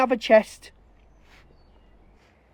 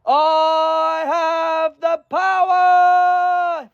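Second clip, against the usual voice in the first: loud, drawn-out mock superhero shout; mock He-Man boast; 5 semitones higher, 3 dB louder, much slower than usual. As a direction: bellow it like a cartoon hero.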